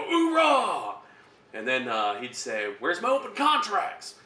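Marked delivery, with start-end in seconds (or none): 0.0-1.0 gung-ho oorah chant, high and loud; loud gung-ho soldier. Retold or imitated. Recruits answering drill instructor. 2.8-4.2 slow drill instructor call, high and loud; loud gung-ho soldier. Retold or imitated. Drill instructor calling out recruits.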